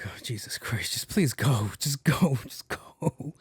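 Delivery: whispery, pleading mock exasperation; exasperated plea. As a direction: beg him under your breath to leave. Retold exasperated plea under his breath.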